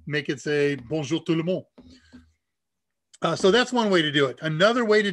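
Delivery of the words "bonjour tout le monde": French phrase in a French accent. Shown as a demonstration. Host shows what the program says, French accent. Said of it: "put French text in".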